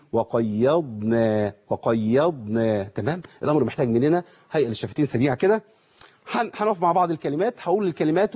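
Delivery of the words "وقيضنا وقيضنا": وقيضنا slow with a light ya and a clear dad; correct form. Shown as a demonstration. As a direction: say وقيضنا carefully. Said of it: "يبقى إيه؟".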